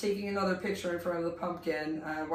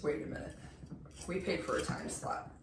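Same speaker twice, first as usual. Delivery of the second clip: hushed whisper, much softer; 3 semitones lower, 9 dB quieter than usual. Retold or imitated. Jen whispering in line about the time slot. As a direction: whisper it urgently under your breath.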